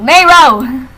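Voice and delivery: an exclaimed, drawn-out name; calling out. Real, not acted. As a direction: call out to a friend. Calls out to friend.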